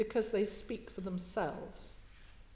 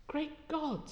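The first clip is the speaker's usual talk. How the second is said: groan of horror; 6 semitones higher, 5 dB quieter than usual. Acted. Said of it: "in a groan of horror".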